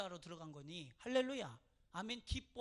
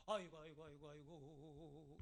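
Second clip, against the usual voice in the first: low, wavering mock wail, trailing away softly; imitated funeral keening; 8 semitones lower, 7 dB quieter, slower than usual. Guest at a funeral, imitated droning lament. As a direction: chant the lament like a mourner going through the motions. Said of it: "이렇게 하잖아요".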